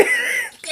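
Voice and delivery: real helpless laugh; high laugh. Real, not acted. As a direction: let out a shrieking giggle. Laughing at the fight scene.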